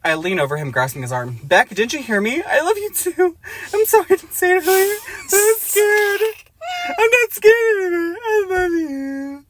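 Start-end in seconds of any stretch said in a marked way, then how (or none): 1.4-9.5 much higher and louder, near tears; hammed-up teary high plea; melodramatic confession. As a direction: wail the confession in overwrought distress.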